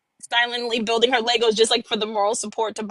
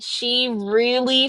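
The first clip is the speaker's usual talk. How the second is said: usual speaking voice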